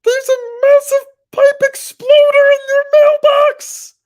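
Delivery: very loud high slow shout. Real, not acted. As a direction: yell it at full blast. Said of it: "I don't wanna shout something".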